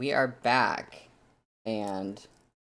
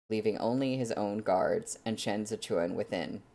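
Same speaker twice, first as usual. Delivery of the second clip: softer, measured audiobook reading; story narration; 4 dB quieter than usual. A narrator's reading. Streamer reading the novel chapter to viewers, calm narration. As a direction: settle in and read the chapter steadily.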